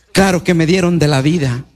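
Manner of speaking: usual speaking voice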